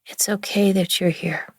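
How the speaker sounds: normal talking voice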